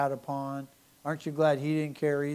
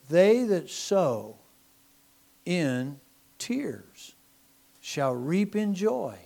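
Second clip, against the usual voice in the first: drawn out, each phrase weighted; slow scripture reading; 3 semitones higher, 4 dB louder, much slower than usual. Acted. Preacher reads the psalm verse to his church, slow and deliberate. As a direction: lay the verse out slowly for the congregation.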